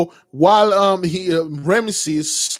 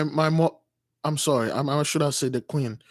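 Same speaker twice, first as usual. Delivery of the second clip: normal talking voice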